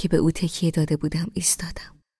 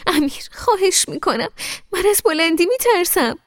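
much higher, wheedling plea; coaxing and pleading; 13 semitones higher, 5 dB louder than usual. Acted. Girl coaxing Amir to stop, wheedling pleading voice. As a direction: sweet-talk him into mercy. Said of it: "با لحنی چاپلوسانه و ملتمس گفتم".

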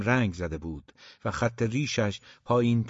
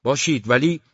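raised, louder angry objection; angry father, loud and higher; 4 semitones higher, 7 dB louder than usual. A narrator's reading. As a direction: cut in angrily, voice pitched up. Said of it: "عصبانی بود".

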